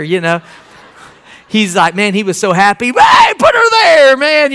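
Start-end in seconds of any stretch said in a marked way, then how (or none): 2.9-4.5 excited greeting. Retold or imitated. Waiter thrilled, offering a handshake.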